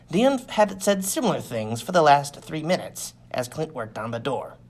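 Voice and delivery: plain reading voice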